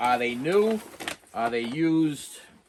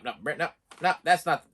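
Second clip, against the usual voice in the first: ordinary delivery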